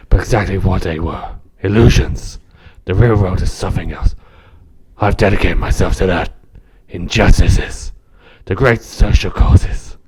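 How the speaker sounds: loud, croaky vigilante growl. Acted. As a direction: croak out a grave monologue like a masked superhero. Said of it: "a bit basic with Croaky Batman".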